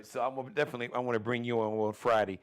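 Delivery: normal talking voice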